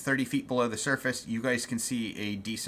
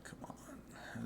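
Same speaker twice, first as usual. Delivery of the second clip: whispering; 20 dB quieter than usual. Real, not acted. GM muttering while searching.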